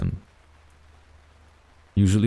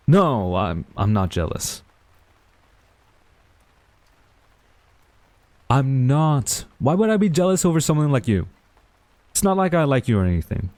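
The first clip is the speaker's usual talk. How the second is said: higher, stammering denial; flustered denial; 6 semitones higher than usual. Acted. Tutor denies jealousy to friend, flustered and higher. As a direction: deny it too fast, caught out.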